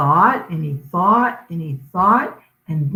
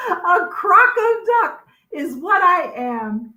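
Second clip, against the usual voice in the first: happy discovery; 10 semitones higher, 4 dB louder than usual. Acted.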